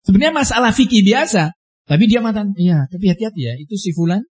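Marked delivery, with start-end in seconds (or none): 2.6-4.2 imitating a confidential, softened warning; hushed warning. Man cautioning others about a certain person, hushed warning.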